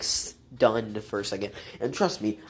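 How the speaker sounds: ordinary talk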